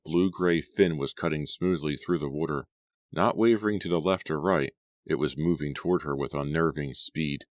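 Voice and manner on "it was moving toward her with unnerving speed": ordinary delivery, nothing marked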